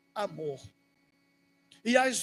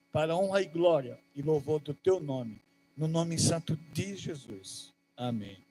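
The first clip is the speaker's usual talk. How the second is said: fervent prayer; 5 semitones lower than usual. Acted. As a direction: pray humbly to God on behalf of the church. Leads a prayer over music. (oração fervorosa e íntima)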